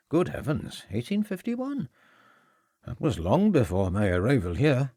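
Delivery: polite, slightly guarded headmaster; Welsh headmaster. A narrator's reading. Headmaster receiving the inspector, light Welsh voice. Edwards surprised.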